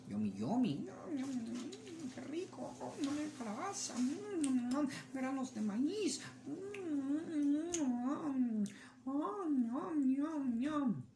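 high, happy nibbling bunny voice; munching rabbit. Acted. Rabbit eating pumpkin and corn, high munching voice. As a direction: chomp away like a greedy little rabbit.

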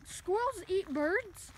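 high, slow astonished question; can't believe it; surprised. Real, not acted.

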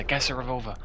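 normal talking voice

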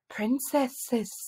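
soft snake hiss. A narrator's reading. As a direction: hiss it softly, stretching the sibilants. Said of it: "hissed the voice from under the rock".

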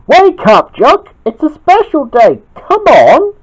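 loud, high-pitched wake-up call; urgent storybook shout; yelling. A narrator's reading. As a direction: yell to rouse a sleeper. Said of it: "yelled Bobby".

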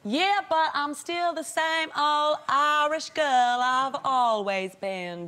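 put-on slow, high American accent; drawn-out American accent. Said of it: "You can do an American accent".

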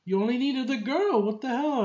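mock betrayed protest; shocked Caliban. Caliban protesting to the mercenaries, high and shocked. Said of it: "Caliban is shocked".

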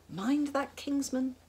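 high, drawn-out warning; slow, high-pitched alarm; urgent warning. A narrator's reading. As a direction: warn him before he treads on someone. Warning brother of sleeper underfoot.

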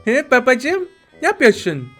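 put-on high, cutesy baby voice with childish mispronunciations; Bebek Gaffur. Player as the baby teasing dad, childish high voice. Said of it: "Hadi o zaman Bebek Gaffur olalım biraz".

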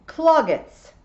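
high, loud announced word; reading aloud. A narrator's reading. Tiffany reading to watching pixies, proclaiming. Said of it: "she read aloud".

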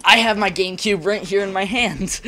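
bursting to show the GameCube; excited. Real, not acted. Excited reveal.

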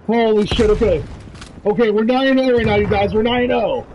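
very high excited squeal; ecstatic disbelief. Real, not acted. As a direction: shriek with joy at the streak. Reaching ninth killstreak.

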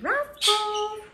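high, loud parrot imitation. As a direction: squawk the name like a bird. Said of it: "Those were the words you were saying".

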